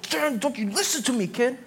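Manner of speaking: high, snippy mock scolding of a child; mock snap. Stressed parent snapping at their kid, a mock scold. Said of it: "we'll snap at our coworkers".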